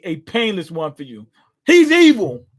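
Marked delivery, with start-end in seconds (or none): 1.6-2.4 loud, high, thrilled exclamation; real excited yelp, much higher and louder; excited outburst. Real, not acted. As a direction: blurt it out, delighted by the villainy. Reacting to villainous hero line.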